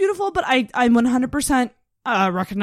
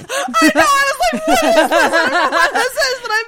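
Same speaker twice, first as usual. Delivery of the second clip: high, loud, giggling delight; real laughter bubbling through; laughing; 13 semitones higher, 8 dB louder than usual. Real, not acted. Laughing reaction to accent.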